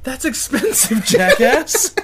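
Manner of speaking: high, loud scolding with a laugh; indignant scolding. Real, not acted. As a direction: scold loudly, half laughing. Scolds friend for clinking the microphone.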